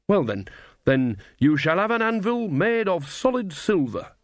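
high, rustic voice regrouping after a stumble; recover, then promise grandly; flustered country mother. A narrator's reading. Nanny, taken aback, promises her son an anvil, high-pitched.

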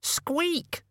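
very high tiny squeak; rat squeak. Acted. Death of Rats squeaks.

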